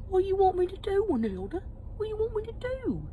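high whining mock husband; whiny husband voice. Gary whining back at his wife, high and pleading. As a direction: whine it like a cornered husband.

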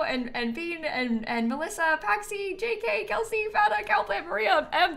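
high rapid name list. Real, not acted. Reading sub names.

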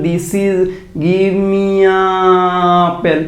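very slow, drawn-out words with long gaps; slow motion reading. Shown as a demonstration. Teacher demonstrates reading "give me a apple" in slow motion. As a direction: read it in slow motion for the class. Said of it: "আমি যদি এইটাকে slow মোশনে পড়ি".

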